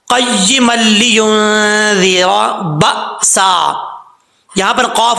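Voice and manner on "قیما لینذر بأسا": very slow, drawn-out recitation; slow recitation demo. Shown as a demonstration. Teacher modelling the next words for students. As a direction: recite slowly in the same way. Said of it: "اب اسی طریقے سے".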